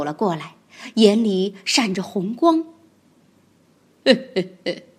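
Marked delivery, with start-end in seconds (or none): 4.0-4.9 低沉阴险的嘿嘿坏笑; 像反派盯着猎物那样笑. Acted. 吉兰泰龙眼里闪红光. (吉兰泰龙阴险地嘿嘿笑)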